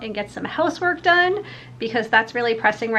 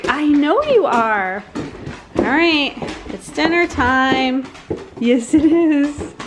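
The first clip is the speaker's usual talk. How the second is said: high, sing-song voice for the dogs; 5 semitones higher than usual. Real, not acted. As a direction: coo at the pups at dinner. Talking to her hungry dogs.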